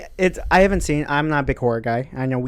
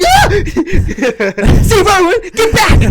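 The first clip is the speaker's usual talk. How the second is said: high frantic screaming; panicked yelling; 8 dB louder than usual. Pretend. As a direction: yell in a panic, high and loud. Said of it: "And you're like".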